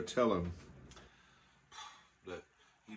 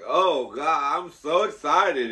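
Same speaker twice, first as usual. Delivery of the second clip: excited gush; 5 semitones higher, 11 dB louder, slower than usual. Retold or imitated. Gushing at the cop.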